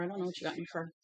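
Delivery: ordinary talk, nothing marked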